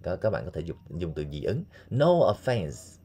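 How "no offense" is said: slow modeled phrase. Shown as a demonstration. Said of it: "teacher demo, slow".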